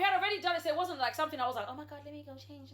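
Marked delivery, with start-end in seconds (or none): 1.7-2.7 mumbled aside, much softer; hushed aside. Retold or imitated. Retelling her own remark about changing, hushed aside. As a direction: drop it under your voice.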